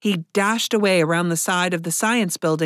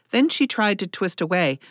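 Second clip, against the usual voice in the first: ordinary delivery, nothing marked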